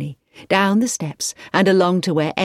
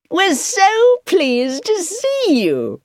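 gushing hostess; 4 dB louder, much slower than usual. A narrator's reading. Hostess voice, Mrs Clayton greeting.

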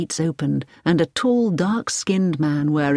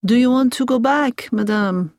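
higher-pitched Frenchman with a courteous question; French doctor; 6 semitones higher than usual. A narrator's reading. Doctor Barron asks Hilary about going back, French doctor voice.

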